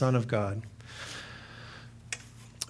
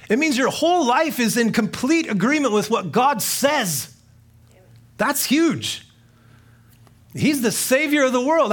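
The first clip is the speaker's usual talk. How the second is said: higher, louder full-force sermon; fervent preaching; 8 semitones higher, 5 dB louder than usual. Acted. Preacher drives home the meaning of confess, fervent. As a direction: preach it like a revival.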